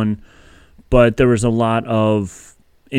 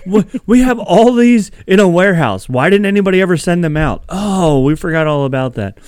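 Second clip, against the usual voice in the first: mock company exec; 6 semitones higher than usual. Imagined company voice, laughing.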